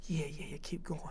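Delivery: a breathless whisper, egging him on; whispered urging. Retold or imitated. Coach whispering to Parsons to keep the act going. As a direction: whisper it so only he hears.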